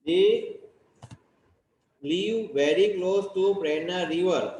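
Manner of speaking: very slow, word by word English dictation. Shown as a demonstration. Teacher dictating the sentence slowly to the class. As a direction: read each word out slowly for copying. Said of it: "teacher dictating English sentence".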